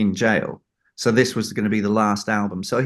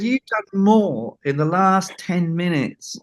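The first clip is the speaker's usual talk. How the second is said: higher, slow emphatic praise; praising John; 8 semitones higher than usual. Retold or imitated. Storyteller voices John praising the assistant, higher and slow. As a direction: drive the compliment home, slowly and pitched up. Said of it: "He said".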